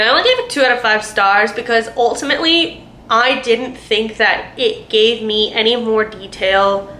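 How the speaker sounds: ordinary talk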